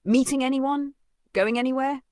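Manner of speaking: pry with bright, lifted questions; prying stepmother. Acted. Stepmother probing at lunch.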